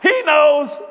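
high, loud brush-off; gruff brush-off. Retold or imitated. Husband dodging his wife's question, loud and curt. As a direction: wave the question away. Repeat of punchline.

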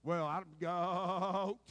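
very slow, loud, high drawn-out bleat; bleating goat. Retold or imitated. Preacher mocking a stubborn believer as a bleating goat. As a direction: stretch it into a long goat bleat.

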